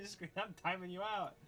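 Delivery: normal talking voice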